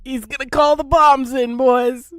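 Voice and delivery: high, loud mock announcement to the troops; mock war announcer. Commentator mocking the kid's bomb threat, in a hammed-up high announcer voice. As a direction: ham up the kid's threat like a battlefield herald.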